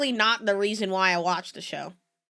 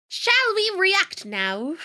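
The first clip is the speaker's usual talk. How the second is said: high, drawn-out mock-British accent; bad British accent; 9 semitones higher, slower than usual. Streamer hamming it up for chat, horrible British accent. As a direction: put on a slow, high-pitched British accent. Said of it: "my British accent better".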